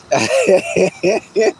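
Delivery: high, tickled chuckle; laughter. Real, not acted. Streamer laughing after calling self handsome and intelligent, high chuckle. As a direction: chuckle at your own boast.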